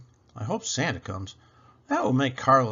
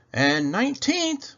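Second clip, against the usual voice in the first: high bright announcement of the floor; excited call; 13 semitones higher, 4 dB louder than usual. Acted.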